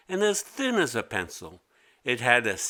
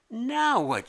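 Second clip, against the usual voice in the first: put-on much higher, fed-up kid; exasperated girl voice; 11 semitones higher than usual. A narrator's reading. Mimi finding her desk empty again, high annoyed. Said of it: "she said".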